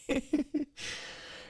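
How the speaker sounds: high-pitched wheezy giggle; high laugh. Real, not acted. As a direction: crack up at your own story. Cracks up at own story.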